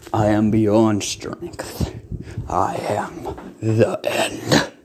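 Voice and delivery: slow, low whispered villain; whispery Lich voice. Acted. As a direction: breathe it out like an undead king.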